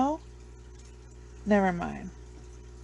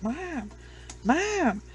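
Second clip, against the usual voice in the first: high-pitched calls to mom; mock pleading interruption; 7 semitones higher than usual. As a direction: imitate a son trying to stop his mother. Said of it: "he's trying to get her to stop".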